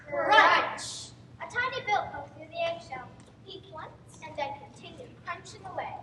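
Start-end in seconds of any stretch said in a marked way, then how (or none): 0.1-1.1 a sudden loud crunch. Acted. Egg cracking sound.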